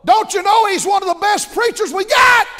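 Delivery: loud, high challenging shout; mock shout. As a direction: shout it back like an offended member. Acted argument with church folk.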